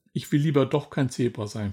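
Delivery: ordinary delivery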